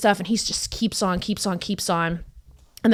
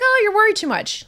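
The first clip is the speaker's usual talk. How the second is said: mocking high voice; 14 semitones higher than usual. Mocking friends' reassurance.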